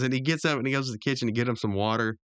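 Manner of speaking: ordinary talk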